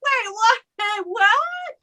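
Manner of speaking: high-pitched, incredulous retold reaction; baffled double-take. Acting out her baffled reaction.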